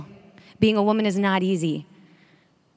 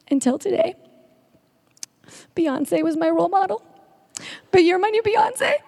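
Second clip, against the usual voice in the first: much higher, imitating a weeping young fan; tearful girl's voice; 9 semitones higher than usual. Speaker retelling a sobbing girl in her arms, high imitated voice. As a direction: pitch it way up like a girl crying out her confession. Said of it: "and she goes".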